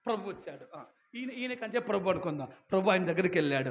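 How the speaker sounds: ordinary talk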